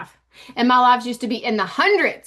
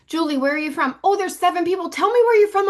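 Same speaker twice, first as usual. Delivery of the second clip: higher, louder cheery host calling to viewers; demo host question; 7 semitones higher, 4 dB louder than usual. Shown as a demonstration. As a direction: sound eager to hear from them. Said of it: "I start to ask questions".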